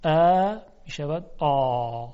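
slow dark آ; throaty alef. Shown as a demonstration. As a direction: pull the tongue back for a dark آ. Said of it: "حلقومیش بکنید صدا رو".

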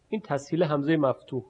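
ordinary talk

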